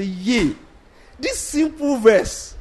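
high, drawn-out cry of mock amazement; fervent preaching. Recalling a preacher from student days, high fervent imitation. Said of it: "preaching with fervor".

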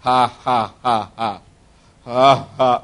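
low, forced ha-ha; imitated dry laugh. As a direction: act out a deliberate, joyless laugh. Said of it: "So he began to laugh".

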